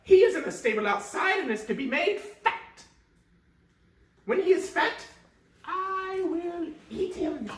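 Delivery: high cackling witch voice; greedy witch, high and loud; wicked witch. Acted. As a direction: play the witch gloating over her meal.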